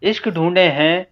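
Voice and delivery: measured, unsung ghazal recital; spoken verse recitation. Acted. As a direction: recite phrase by phrase with deliberate pauses. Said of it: "मैं तहत में ही पढ़ता हूँ".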